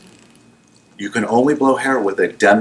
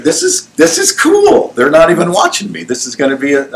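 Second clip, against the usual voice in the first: high, smug self-talk, slowed down; pleased with himself and sure nobody is watching; a giddy, overconfident apprentice; cocky inner voice; 8 semitones higher, 4 dB louder than usual. Retold or imitated. Cocky inner thoughts while cutting.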